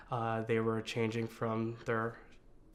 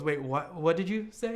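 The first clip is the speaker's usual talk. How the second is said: puzzled mother; 5 semitones higher than usual. Retold or imitated. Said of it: "didn't really hear me".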